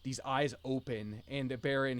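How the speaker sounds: usual speaking voice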